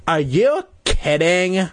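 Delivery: high, drawn-out disbelief; cried in surprise. A narrator's reading. Louisa reacting to the cave question, shocked cry. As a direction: stretch it out, shocked at the idea. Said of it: "Louisa cried".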